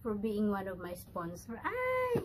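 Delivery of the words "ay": very high, drawn-out squeal; delighted squeal. Real, not acted. Squeals with joy thanking sponsors.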